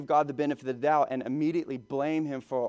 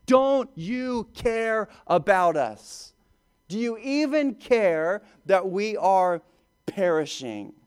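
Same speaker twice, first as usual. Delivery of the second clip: slow, louder, higher pleading; desperate plea; 5 semitones higher, 4 dB louder, slower than usual. Retold or imitated. Disciples burst in on sleeping Jesus, pleading slowly and loudly. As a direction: drag each word out in desperate fear. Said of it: "and they say".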